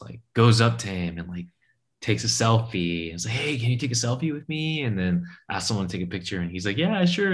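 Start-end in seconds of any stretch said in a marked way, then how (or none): 3.3-4.8 higher put-on tourist voice; a higher, lightly voiced tourist asking for a photo. Tourist asks stranger for selfie. 6.8-7.3 much higher, breezy mock reply; a higher, easygoing voice for the stranger; casual agreement.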